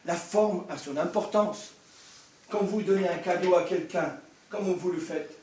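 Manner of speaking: ordinary talk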